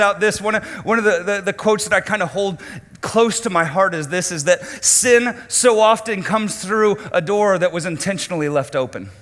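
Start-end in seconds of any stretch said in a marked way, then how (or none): none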